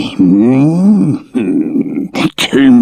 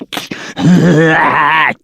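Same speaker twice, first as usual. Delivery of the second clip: gloating troll laugh; 8 semitones higher than usual. Acted. Troll gloats before tasting.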